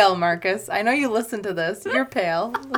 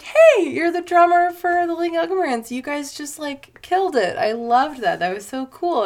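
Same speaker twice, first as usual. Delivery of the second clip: excited fan; 7 semitones higher, 4 dB louder than usual. Retold or imitated. Fan gushing at drummer.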